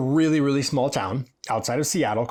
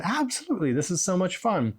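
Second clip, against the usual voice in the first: over-the-top eager; 6 semitones higher than usual. Retold or imitated. Hairdresser's overly eager reply.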